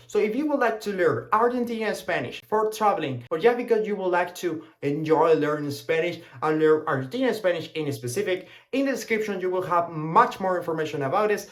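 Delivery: quick, upbeat promo pitch; ad read. Acted. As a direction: sell the group lessons, quickly and brightly.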